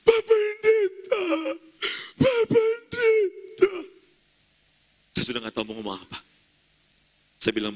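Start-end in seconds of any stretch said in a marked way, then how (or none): 0.1-3.7 long drawn-out, high, loud wail; wailing cry. Retold or imitated. Newly widowed wife crying out to the pastor, grieving wail. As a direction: wail out to the pastor, imitating grief. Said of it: "Langsung teriak".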